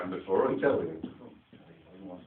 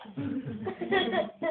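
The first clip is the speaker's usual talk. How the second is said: high giggling laugh; a boy laughing his head off in bed; 4 dB louder than usual. Retold or imitated. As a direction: let out a big high childish giggle. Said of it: "I laughed my head off".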